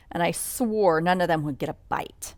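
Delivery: usual speaking voice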